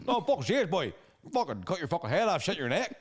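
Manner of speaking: hammed-up Ballymena hard man swagger; Ballymena fighter impression. Fighter trash-talking UFC opponents, in a Ballymena accent, hammed up. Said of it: "that's a pretty good Reece impersonation".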